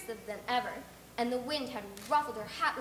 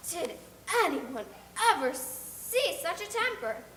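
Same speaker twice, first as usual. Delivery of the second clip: aghast; 6 semitones higher, 4 dB louder, slower than usual. Acted. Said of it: "exclaimed Marilla in consternation".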